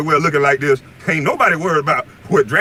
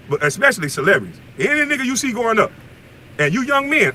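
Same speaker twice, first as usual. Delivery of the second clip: normal talking voice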